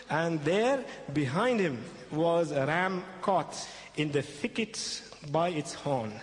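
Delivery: usual speaking voice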